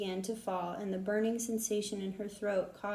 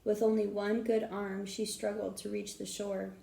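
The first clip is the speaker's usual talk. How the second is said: usual speaking voice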